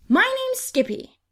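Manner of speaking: put-on eager boy. A narrator's reading. Skippy repeating his name to Angel, high and eager. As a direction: chirp the name again like a kid. Boys repeat names excitedly.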